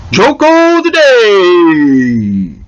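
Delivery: loud, long-stretched segment announcement; drawn-out announcer call. Pretend. Host kicking off the joke of the day, drawn-out announcer call. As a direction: belt out the title, stretching it until the pitch slides down. Segment title called out like jingle.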